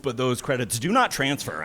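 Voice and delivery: ordinary talk, nothing marked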